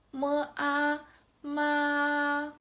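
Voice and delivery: "mo, a, ma" held on a high level pitch; first tone. Shown as a demonstration. Teacher blends mo and a into ma, high flat first tone. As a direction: keep "ma" high and flat, no rise or turn. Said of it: "key word of the first tone is flat".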